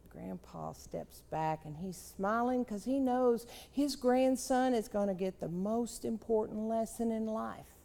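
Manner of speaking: usual speaking voice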